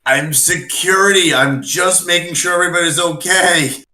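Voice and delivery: hammed-up security excuse; defensive bluff. Player bluffs screaming woman in character.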